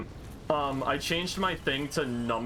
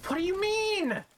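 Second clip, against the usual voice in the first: surprised squeak. Real, not acted. Sudden high squeak at the game.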